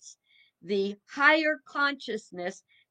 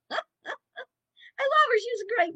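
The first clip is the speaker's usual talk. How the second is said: tearful laugh; 13 semitones higher, 4 dB louder than usual. Real, not acted. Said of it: "laughs, cries".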